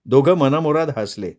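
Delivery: plain reading voice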